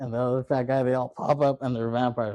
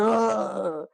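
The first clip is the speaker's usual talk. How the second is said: imitated pain cry; 9 semitones higher than usual. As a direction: act out being bitten. Bitten in the neck.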